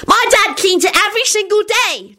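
put-on high child's yell; very high, excited yell; loud yell. A narrator's reading. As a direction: pipe up in a high shout. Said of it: "Teddy yelled loudly".

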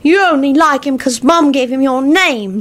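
put-on mildly sulky kid voice; sulking child. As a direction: sulk it like a small boy. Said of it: "sulked Teddy".

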